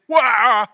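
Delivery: sudden high, loud whoop; loud joyful whoop. Shown as a demonstration. Preacher showing David turning the song up, loud whoop. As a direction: burst out at full volume. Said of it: "He turns it up".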